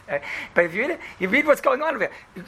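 ordinary delivery

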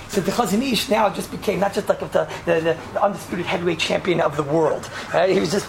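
ordinary talk

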